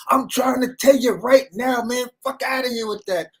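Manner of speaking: Brooklyn-style heated shout. Retold or imitated. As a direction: bark it like a heated Brooklyn friend, hands clapping. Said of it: "that Brooklyn shit where we start clapping".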